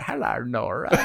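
grandfather voice. Pretend. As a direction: greet the grandchild in a mild, low old grandpa voice. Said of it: "you know your grandfather voice".

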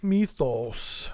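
Mythos as MEE-thohs, low and drawn out; echoed pronunciation. Retold or imitated. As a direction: echo Mythos low, with a long "ee". Echoing co-host's odd pronunciation.

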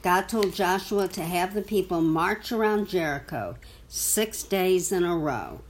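ordinary delivery, nothing marked

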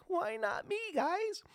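high, whiny why-not-me; whiny complaint. Retold or imitated. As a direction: whine like a passed-over poet. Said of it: "a tinge of, like".

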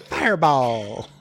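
loud playful battle cry; mock spell shout. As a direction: call it out loudly, laughing around it. Game master jokingly calls the spell.

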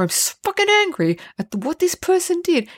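high-pitched mock fuming; angry mulling. Host mimics someone mulling over what a person did, mock angry. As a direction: imitate an exasperated rant, pitch way up. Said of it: "You just sit there mulling over it".

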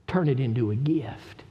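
usual speaking voice

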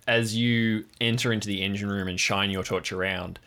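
ordinary talk

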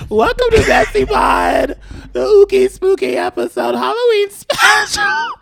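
high, strained, cracking demon-costume voice. Real, not acted. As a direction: croak the show's welcome with a wrecked voice. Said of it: "I am currently voice dressed like a demon".

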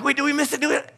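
imitating alarmed kids, high-pitched; high, anxious child's question; mock panic. Boys to parents after missing the message, panicked.

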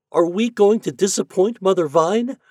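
high, loud worried questions; dismayed cry. A narrator's reading. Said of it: "he cried".

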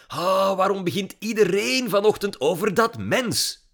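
put-on high, loud teenage exasperation; irritated outburst on a loud sigh; exasperated grumbling. A narrator's reading. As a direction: burst out fed up, pitch way up. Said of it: "voeterde Lily".

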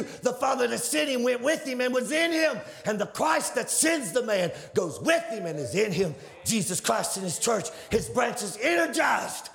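high, rising sermon delivery, shouting the peaks; fiery preaching. Acted. Preacher to the congregation about the vine, fiery.

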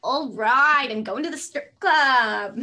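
very loud, slow Linda whoop; Linda impression. Second friend trying Linda's strip club line. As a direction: belt it out like Linda. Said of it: "Linda from Bob's Burgers".

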